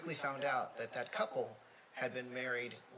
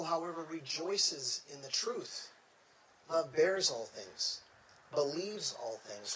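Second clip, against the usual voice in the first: scripture reading; 2 semitones higher, 4 dB louder than usual. Acted. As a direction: read each line with reverence. Reads scripture at the wedding.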